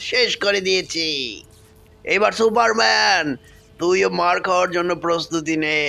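low, menacing villain voice, gloating; low villain gloating over the bike; menacing Venom. Acted. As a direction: plant the bomb like a smug monster.

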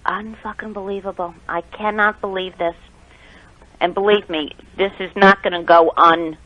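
stunned, muttering under breath; disbelieving mutter. Real, not acted. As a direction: say it to yourself in disbelief.

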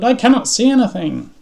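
high, loud complaint; alarmed cry. A narrator's reading. Toad dazzled by the sun, crying out. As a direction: wail it in acted alarm.

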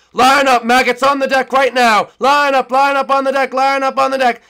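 loud bossy captain barking orders; captain's shout. Acted. Captain ordering the crew on deck, a loud bark.

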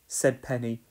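plain reading voice

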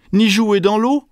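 put-on high little child's voice with a cold; sniffly baby hippo. A narrator's reading. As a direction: whine it high as a snuffly baby. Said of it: "renifla Bébé Hippo". (voix aiguë de bébé enrhumé)

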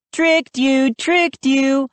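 high, teasing singsong; gleeful cheer. A narrator's reading. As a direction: cheer at fooling her. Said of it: "I cheered".

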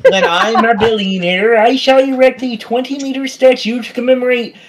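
high pompous tycoon declaration; grandiose voice. Pretend. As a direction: proclaim it like a vain billionaire. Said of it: "It's hard to talk in this voice".

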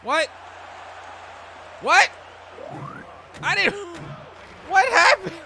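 shocked disbelief. Real, not acted. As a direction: react like you can't believe it. Shocked by game outcome.

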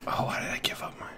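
fast, frustrated unvoiced whisper; rushed hushed self-reproach. Real, not acted. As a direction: hiss it out quickly to yourself. Regrets losing position, hiding.

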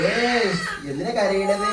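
high-pitched cooing call; singsong fuss over an infant. Real, not acted. Calls to crying baby.

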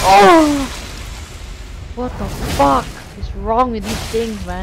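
loud high raging yell; raging gamer. Real, not acted. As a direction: explode at the game. Gamer hit by enemy.

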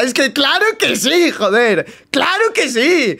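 ordinary talk, nothing marked

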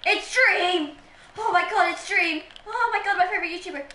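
high, gushing fan squeal; pretend Dream stan. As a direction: gush like a starstruck fan, playing it up. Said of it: "pretending to be a Dream stan".

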